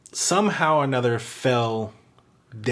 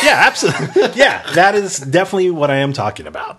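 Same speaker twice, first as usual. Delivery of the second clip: louder, higher, laughing through the words; 6 semitones higher, 7 dB louder than usual. Real, not acted. To the host, insisting he meant the game, laughing. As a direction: laugh it off while agreeing.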